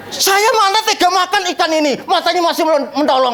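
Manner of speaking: high, fussy mock complaint; a lightly voiced picky woman. Woman refuses fish staring. (keluhan rewel bernada tinggi)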